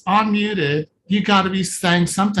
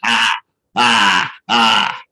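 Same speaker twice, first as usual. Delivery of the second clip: put-on low baby babble; 9 semitones lower, much slower than usual. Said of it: "the first expression of the child is ex-, voice".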